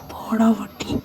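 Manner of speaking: hushed, breathy jab under the breath; whispered insult. Acted. Teasing his friend in a sneaky whisper. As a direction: whisper it so the others can't hear.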